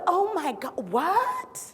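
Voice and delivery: incredulous, pitch leaping up on the question; mock shock. People hearing of long-known health disparities, mock astonished. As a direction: act out disbelief, ending on a high rising what. Mimics shocked reaction to statistics.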